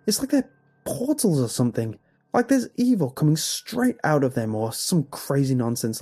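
agitated protest. A narrator's reading. Father contradicts his wife about mirrors, agitated protest. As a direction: argue back in rising alarm. Father argues back, panicking.

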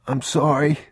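put-on a small boy feeling ill; sick boy. Boy apologizes.

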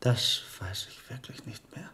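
hushed, unsure whisper; whispered confession of forgetting. Acted. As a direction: breathe it out, barely voiced. Cannot remember who he told.

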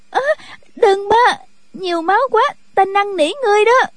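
put-on high-pitched frightened girl's pleading; panicked cry. A narrator's reading. Girl begging at the sight of blood, high panicked cry. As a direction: wail in terror, pitched far up. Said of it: "tiếng cô gái la lên thất thanh".

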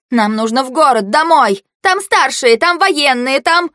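high-pitched, agitated argument; agitated protest. A narrator's reading. Girl arguing to go home to the city, agitated. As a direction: argue urgently, pitch riding high.

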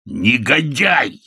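drawn-out, high outburst; indignant exclamation. A narrator's reading. Mr Bennett denounces Sam on hearing his name, indignant. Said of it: "воскликнул мистер Беннет".

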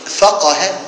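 فقه said "fáqaha", with a on the middle qaf; middle vowel a. Shown as a demonstration. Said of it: "بفتح العين".

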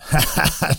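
high mocking laugh; bandit laugh. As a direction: scoff with a short laugh. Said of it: "El bandido se echó a reír".

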